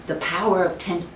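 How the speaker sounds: usual speaking voice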